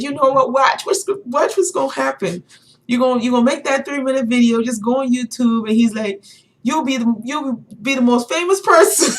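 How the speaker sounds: high, loud eager prediction; imitating excited man. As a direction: hype it up as him. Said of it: "he was so passionate about what he was talking about".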